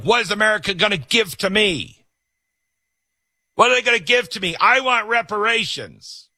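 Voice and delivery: high-pitched mock demands, pleading greedily; mock entitled whining. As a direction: whine it like a demanding crybaby. Mocking entitled people in a quote.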